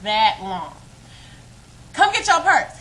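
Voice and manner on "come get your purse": higher, louder mock of an angry boyfriend; angry demand. Boyfriend orders her to fetch purse, mock angry. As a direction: bark it at her down the hall. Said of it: "mad as I don't know what".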